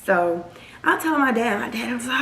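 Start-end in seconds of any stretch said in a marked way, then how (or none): none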